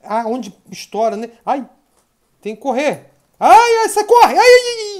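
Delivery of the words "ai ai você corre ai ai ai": high, loud yelps; panicked squealing. Real, not acted. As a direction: shriek while running from danger. Foge em pânico no jogo. (gritos agudos de pânico)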